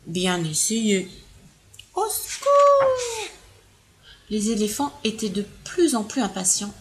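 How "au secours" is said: high, very slow wail; slow high cry for help. Acted. Third elephant falling from the tree, crying out.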